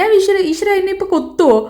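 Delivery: high, panicky retelling of the ostrich scare; retold panic. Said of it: "എനിക്ക് പേടിയായി".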